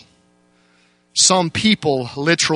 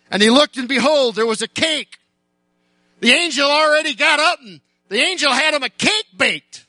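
preaching at full force; 9 semitones higher than usual. Acted. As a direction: drive the story home to the church, raised high.

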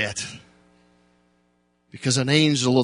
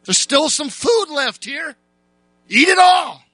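high shouted urging, louder on the end; preached shout; 12 semitones higher, 3 dB louder than usual. Retold or imitated. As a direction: push him to eat, calling out.

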